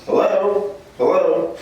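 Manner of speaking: high-pitched sing-out hello; imitated high-pitched greeting; father-in-law calling out. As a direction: call out high from another room. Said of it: "he'd hear me and he'd go".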